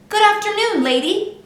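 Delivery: high, loud, proper little greeting; very polite. A narrator's reading. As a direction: answer the teacher very politely. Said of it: "very polite".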